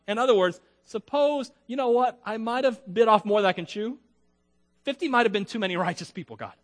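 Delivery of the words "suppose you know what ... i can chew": higher, anxious musing; uh-oh, bit off too much; lightly hammed worry; nervous second thoughts. Voicing Abraham's second thoughts.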